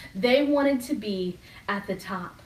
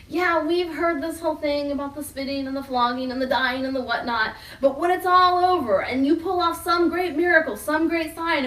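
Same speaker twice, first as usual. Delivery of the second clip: higher, louder, brushing it off; dismissive disciples; 5 semitones higher, 5 dB louder than usual. Retold or imitated. Disciples shrugging off Jesus' warnings, eager and loud. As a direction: wave away the warnings, eager for glory. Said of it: "they're all thinking".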